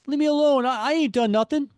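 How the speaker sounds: high, loud whining protest; whiny pleading. Acted. Joe Face pleading with Spider-Man, high whiny protest. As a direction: beg to be left alone, voice up high.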